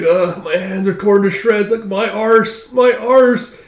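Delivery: high-pitched whining; groaning complaint. Acted. Exhausted youngsters after rowing, groaning. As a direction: moan about your sore body.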